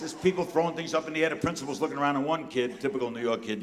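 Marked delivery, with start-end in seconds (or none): none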